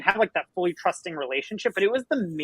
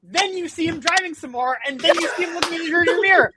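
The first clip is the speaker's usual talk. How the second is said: exasperated high-pitched; 10 semitones higher, 4 dB louder than usual. Real, not acted.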